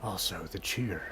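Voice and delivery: hushed, whispering ancient tree spirit; talking tree. Acted. Spirit tree speaks to hero.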